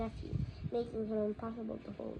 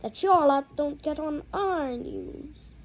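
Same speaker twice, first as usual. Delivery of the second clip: ordinary delivery, nothing marked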